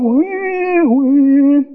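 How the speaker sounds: high mock whine; hammed-up whimper. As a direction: whimper it like a child.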